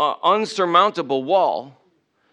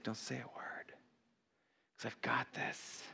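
whispered, calming command; God's hushed reply; 3 semitones lower, 21 dB quieter than usual. Acted. God quieting someone desperate to answer back, hushed whisper. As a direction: whisper it gently, very soft and low. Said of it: "And God is like".

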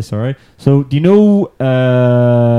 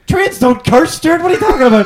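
put-on higher, lightly accented Sligo scold; put-on mock scold; 4 dB louder than usual. Mocking a friend's accent to Stuart, mock scold. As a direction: tell him off in a mock local voice.

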